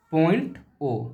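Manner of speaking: ordinary talk, nothing marked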